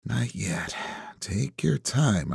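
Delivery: hushed, near-whispered self-talk; drawn-out, soft note to yourself. A narrator's reading. Investigator holding back his questions, hushed self-talk. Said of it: "I say to myself".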